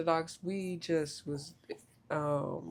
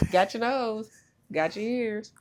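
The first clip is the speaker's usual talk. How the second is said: playful; 5 semitones higher, 7 dB louder, slower than usual. Pretend. Playing got your nose game.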